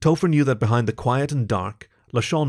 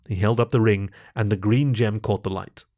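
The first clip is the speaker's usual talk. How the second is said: ordinary delivery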